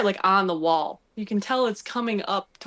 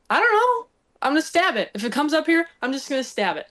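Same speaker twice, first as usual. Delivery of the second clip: high, loud frantic threat; panicked resolve; 6 semitones higher, 4 dB louder, faster than usual. Retold or imitated. Older sister in the hunting blind, frantic and high. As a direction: squeal it like a scared kid with a knife. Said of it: "And she's like".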